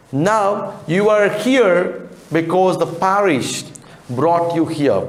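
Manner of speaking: high, slow, stern questioning. Acted. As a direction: press the boy slowly, sternly. Gentleman interrogates Oliver slowly.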